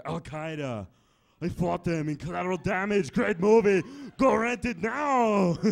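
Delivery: hammed-up movie-star plug, ending slow; action-hero impression. Arnold impression in bar story.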